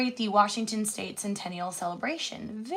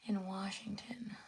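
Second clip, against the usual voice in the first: whispered; 11 dB quieter than usual. Real, not acted. Whispered while searching.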